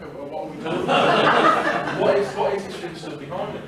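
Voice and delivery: half-laughing, mock baffled. Real, not acted. Mock-baffled retold question, laughing.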